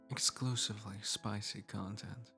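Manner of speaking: ordinary talk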